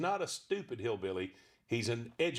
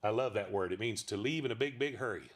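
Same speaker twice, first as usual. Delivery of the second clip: usual speaking voice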